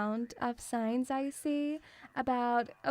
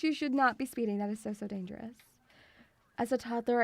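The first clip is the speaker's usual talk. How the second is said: ordinary talk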